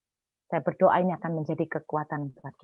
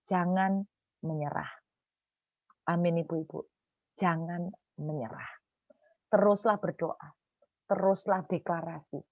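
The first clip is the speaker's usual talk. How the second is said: soft, measured, lowered intensity; solemn pastoral exhortation; 2 semitones lower, 5 dB quieter than usual. Real, not acted. Preacher gives mothers tonight's message, soft solemn exhortation. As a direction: urge them gently, phrase by phrase.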